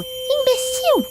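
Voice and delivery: long high-pitched cry of an insult; high shout. A narrator's reading. Flying fairy yells an insult at someone, high drawn-out shout. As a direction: shout it high, drawing out the word. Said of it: "Sininho voando gritou".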